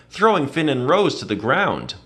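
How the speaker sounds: plain reading voice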